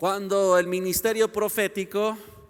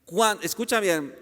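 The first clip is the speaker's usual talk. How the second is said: ordinary talk, nothing marked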